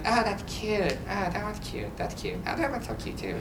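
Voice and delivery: soft, hushed mock girlish cooing; cooing girls. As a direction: gush quietly over photos like the teen girls. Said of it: "they're, like, looking".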